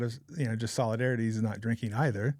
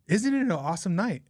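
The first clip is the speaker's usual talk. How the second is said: imitating a chirpy stranger, higher pitch; lightly acted, high-pitched delight at the evening; chirpy greeting; 7 semitones higher than usual. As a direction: greet someone with mock wonder, voice lifted. Strange lady greets them in dark.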